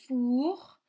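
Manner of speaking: "four" alone, loud with long ou; isolated syllable. Shown as a demonstration. Teacher isolates the first syllable for children, long loud "four". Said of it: "dans la première syllabe".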